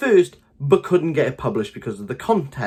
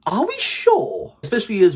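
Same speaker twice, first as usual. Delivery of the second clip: incredulous; 10 semitones higher, 3 dB louder than usual. Real, not acted. Doubting the cover's fit.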